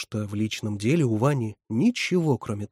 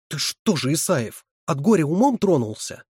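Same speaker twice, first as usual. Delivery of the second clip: louder, higher scolding; angry rebuke; 5 semitones higher, 5 dB louder than usual. A narrator's reading. Резина bangs the lectern at Исаев, angry rebuke. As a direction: rebuke him with indignation. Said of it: "стукнула ладонью по кафедре Ризина".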